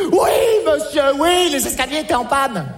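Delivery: plus aigu et fort; répondre au monsieur en criant. Acted. Crie au monsieur. (cri aigu)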